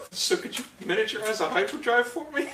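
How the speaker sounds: laughing retold ask. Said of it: "He just asked the kid".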